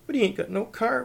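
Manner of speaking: high, loud wail of protest. A narrator's reading. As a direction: wail it like a thwarted girl. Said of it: "wailed Elsie".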